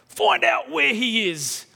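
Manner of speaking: slow, commanding shout; a king barking an order at his officers, shouting slowly; king's order. Acted. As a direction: bark the order like an enraged king. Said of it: "the king ordered".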